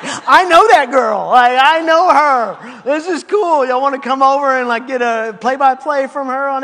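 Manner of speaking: put-on thrilled to know the girl; excited. Student telling friends on the Quad, gleeful and loud. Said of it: "I'm like".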